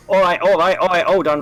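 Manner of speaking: urgent guard shout; calling out. Acted.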